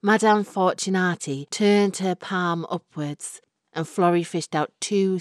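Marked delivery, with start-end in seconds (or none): none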